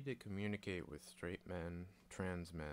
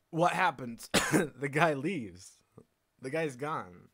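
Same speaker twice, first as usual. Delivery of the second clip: much louder, slow, high payoff; loud punchline; 5 semitones higher, 11 dB louder, slower than usual. Real, not acted. Telling viewers how the guy leaves, loud and slow. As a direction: land it big and slow.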